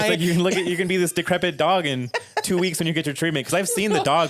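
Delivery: loud, high, laughing hard. Real, not acted. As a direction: shout it out while laughing. Cohost cracks up too.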